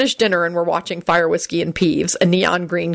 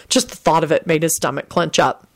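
ordinary delivery, nothing marked

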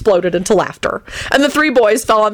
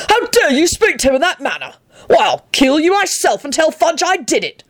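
high, indignant threat; outraged shouting; 9 semitones higher than usual. Acted. Arthur threatens Grindlittle writhing on the ground, high outrage. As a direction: scold in high-pitched fury. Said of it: "Arthur shouted at Grinliddle".

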